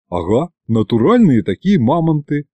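usual speaking voice

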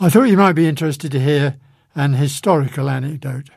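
ordinary talk, nothing marked

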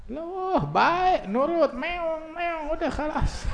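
high, drawn-out mock amazement; amazed husband. Preacher playing a husband amazed at his tamed wife, mock astonished. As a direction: marvel in a high, stretched voice.

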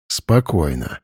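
low, steady soothing command; calm deep male voice. Acted. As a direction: settle her with a low calm word. Grigory calms her.

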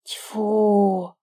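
sharp spitting "Тьфу"; a snake spitting in contempt; spit. A narrator's reading. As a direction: spit it out in disgust. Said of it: "сплюнул змей".

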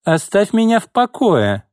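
high-pitched, spiteful outburst. A narrator's reading. Boss orders her secretary off, spitefully. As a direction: fire it back high and angry. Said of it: "злобно произнесла Изольда".

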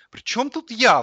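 high, loud protest; indignant. A narrator's reading. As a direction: protest your innocence, outraged. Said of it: "возмутился телевизор". (возмущённо, высоко и громко)

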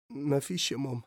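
quiet, tearful denial. A narrator's reading. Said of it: "قائلًا بصوت يملأه البكاء".

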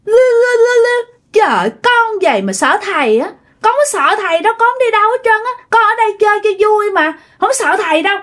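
put-on high babble into cocky child talk; defiant little child. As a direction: boast like a small kid who fears nobody. Spirit child defies the healer.

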